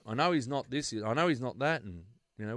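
normal talking voice

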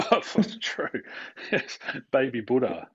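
saying it through real laughter; laughing. Real, not acted. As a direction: chuckle the words out. Joke about enlightened baby.

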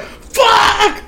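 high, loud acted scream of rage; losing it. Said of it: "he's losing his shit".

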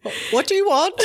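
high, loud mock voice assistant breaking into laughs; Alexa. Smart speakers answering the podcast, high laughing assistant impression. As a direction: pipe up as an eager smart speaker, half laughing. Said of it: "every Alexa, when we publish this podcast, is gonna go".

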